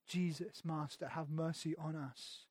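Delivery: soft, slow, pleading; hushed plea. Retold or imitated. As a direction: beg it quietly, almost to yourself. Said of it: "they start shouting".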